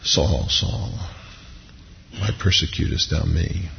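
soft, low, sorrowful appeal; hushed, grieving voice from heaven; pathos and heartbreak. Acted. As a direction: quote it gently, as if wounded. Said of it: "there's a pathos and a heartbreak to it".

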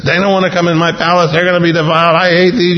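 peeved, hurried grousing in Pilate's voice; annoyed Pilate. Acted. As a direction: complain like a fed-up governor. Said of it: "Pilate's not happy about any of this".

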